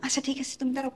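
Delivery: low, very soft murmur; hushed agreement. Acted. Wife agrees to husband's plan, hushed. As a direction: agree so no one outside hears.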